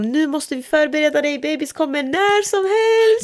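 high voice, rising and slowing; mock-urgent staff voice; urgent announcement.

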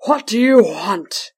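loud, slow, booming roar; roaring North Wind. A narrator's reading. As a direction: bellow it from far off. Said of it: "he roared out from afar".

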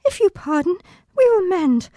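high, loud, tearful lines; sobbing recitation. Acted. Child actress sobbing through Puck's last lines. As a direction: force the verse out through a sob. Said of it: "A big sob".

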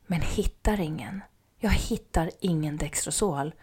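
soft whisper, sinking; hushed dismay; whispered dread. Retold or imitated. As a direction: drop to a whisper as hope fades. Can't find the sugar.

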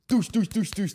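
loud high mock thud noises; imitated thuds down stairs. Imitating the paint pot thudding down the stairs, loud and high. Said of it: "all I heard was".

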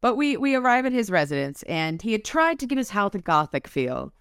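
ordinary talk, nothing marked

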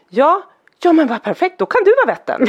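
put-on boy thrilled his uncle can be the vätte; excited little boy. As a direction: play the thrilled kid who found his vätte. Child voiced excitedly in retold story.

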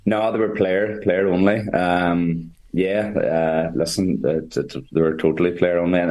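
normal talking voice

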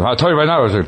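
high, punchy impression of a comic pal; imitating Steve Pearl. Mimicking Pearl's catchphrase to his friend, lightly voiced. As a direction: bark it like your buddy doing his bit. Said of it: "he does the same".

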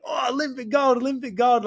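high-pitched imitation of an overexcited family; losing their minds. Imitating the old guy's family meeting an Olympian, losing their minds. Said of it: "they start losing their mind".

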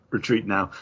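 normal talking voice

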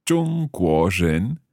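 中国人 drawn out slowly; careful learner echo; slow repeat. Shown as a demonstration. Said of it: "我是".

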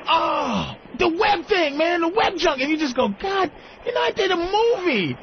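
high, excited gushing fan. Retold or imitated. Fan spotting the actor by his car, excited.

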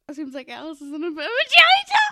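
exaggerated Cockney, bursting out loud; mock Cockney. Quoting the film's line to co-host, mock Cockney. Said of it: "It's supposed to be Cockney".

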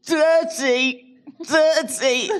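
put-on high little-kid voice saying dirty; a toddler complaining sleepily; high childlike whine; mimicking toddler. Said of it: "points to his mouth and goes".

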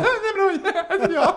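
very high, flustered squeal; generad falsett. Acted. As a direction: squeak it out in mock embarrassment. Acts out flustered reply when recognised. (pipigt, spelat generad)

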